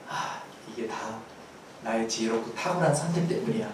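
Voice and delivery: quietly credit yourself, lightly acted; self-congratulating musing. Preacher mimicking people after good fortune, mock self-congratulation. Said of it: "좋은 결과 생긴 것이라고 착각을".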